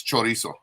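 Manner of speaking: CHORIZO with full 'cho' and tapped r; Spanish pronunciation. Shown as a demonstration. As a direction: say CHORIZO as 'cho-DEE-so'. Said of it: "The proper way is".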